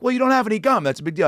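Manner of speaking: high indignant Larry whine; Larry David impression. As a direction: complain like a petty Larry. Retelling Larry David in the cab.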